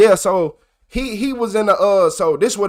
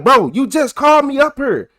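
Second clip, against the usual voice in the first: higher, raised accusation; retold hyped protest; 7 semitones higher than usual. Accusing the principal in his office, raised and higher. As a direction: call him out, fired up. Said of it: "I'm getting hype".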